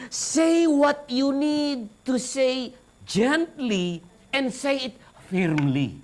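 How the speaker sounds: unhurried, gentle demonstration; slow model of a gentle rebuke; slow gentle delivery. Shown as a demonstration. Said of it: "Say it with gently and firmly".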